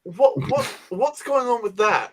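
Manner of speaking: what on earth are they doing; baffled disbelief. Real, not acted. Host reacts to dinosaurs joining the Mandalorians, incredulous. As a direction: pitch jumps up in a slow, incredulous what.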